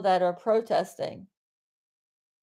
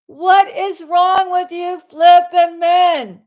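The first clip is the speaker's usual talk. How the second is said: slow, loud exasperated yell at the men; real yelling; 5 semitones higher, 8 dB louder, slower than usual. Real, not acted. Livestreamer yelling at men who dress as women, slow and loud. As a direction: shout it out, fed up with them. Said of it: "Am I yelling?".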